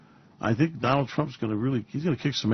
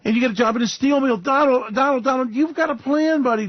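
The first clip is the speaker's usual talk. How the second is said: mock pep talk; 10 semitones higher, 4 dB louder than usual. Mock address to Donald.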